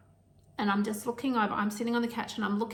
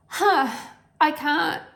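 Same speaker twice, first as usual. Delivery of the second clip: choked gasp; 6 semitones higher, 5 dB louder, slower than usual. Retold or imitated. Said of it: "I remember looking over at my kids thinking".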